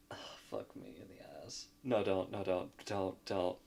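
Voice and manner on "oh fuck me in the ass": breathless whispered aside. Real, not acted. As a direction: whisper it to yourself, exasperated. Flustered aside under breath.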